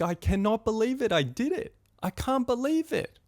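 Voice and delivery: high, disbelieving thrill; retold elation. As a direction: relive the adrenaline rush, pitch climbing. Recalls his adrenaline rush after jumping.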